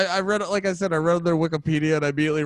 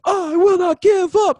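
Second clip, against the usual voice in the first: very high, loud hammed-up vocalist yell; mock hardcore scream; 14 semitones higher, 8 dB louder than usual. Runner hyped by hardcore music, mock screamed lyrics. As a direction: belt it like a hardcore singer at the breakdown.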